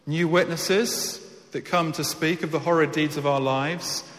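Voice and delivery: ordinary talk, nothing marked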